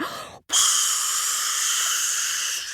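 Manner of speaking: big breath, then long whooshing hiss; spewing water. Acted. Cockerel spitting water onto hot coals, long whoosh. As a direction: imitate water gushing out at length. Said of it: "and he went".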